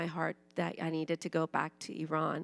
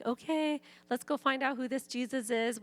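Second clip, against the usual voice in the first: higher, eager retold reply; spring into it, ready to go; 6 semitones higher than usual. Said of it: "and I was like".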